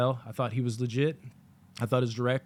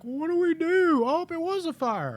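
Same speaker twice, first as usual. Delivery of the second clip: mock helpless wail; 4 dB louder than usual. Mocking people stuck in their seats, helpless wail. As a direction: wail it like a hapless panicker.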